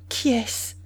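a trembling voice on the edge of sobbing; quavering, near tears. Acted. A betrayed mistress asks her lover who the bride is, quavering. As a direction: ask it while fighting back tears. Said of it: "avec ce ton chevrotant des femmes qui vont sangloter".

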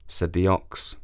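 ordinary delivery

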